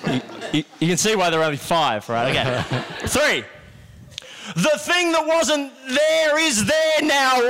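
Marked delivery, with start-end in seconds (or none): 4.5-7.4 high, slow, shouted announcement; grand magician shout. Pretend. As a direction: proclaim it to the crowd.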